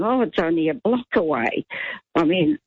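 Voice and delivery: normal talking voice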